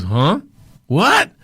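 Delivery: loud, high mock disbelief; hammed-up incredulous Southern boss; Charlie. Boss hears the job is refused, loud mock shock. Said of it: "He goes".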